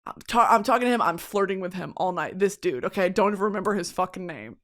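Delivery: usual speaking voice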